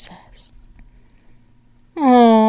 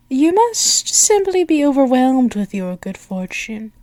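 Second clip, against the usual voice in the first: ordinary talk, nothing marked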